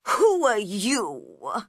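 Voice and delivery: grumpy caterpillar, pointed and loud; unfriendly. A narrator's reading. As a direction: demand it with open dislike. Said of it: "in a very unfriendly voice".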